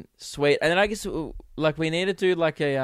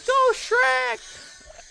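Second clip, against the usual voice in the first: very high squeaky cheer; high-pitched mock crowd cheer; cheering swamp animals. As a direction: squeal it like a tiny cartoon critter. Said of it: "all the swamp animals are like".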